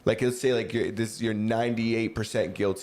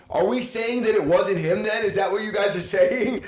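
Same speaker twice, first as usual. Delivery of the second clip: high, louder disbelieving questions; incredulous; 8 semitones higher, 3 dB louder than usual. Real, not acted. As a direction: ask in raised disbelief.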